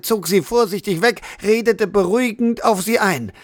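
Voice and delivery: ordinary delivery, nothing marked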